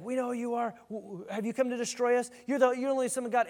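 high, panicky mock demon voice; freaked-out demon. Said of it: "This demon is freaking out".

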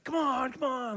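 put-on high whiny child coaxing come on; the boy nagging his dad to pull in; pleading kid. Son pleading in retold scene.

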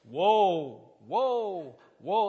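booming drawn-out prophet's wail; mock Isaiah. Preacher mocking Old Testament phrasing to his church, booming prophet wail. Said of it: "It's so Isaiah".

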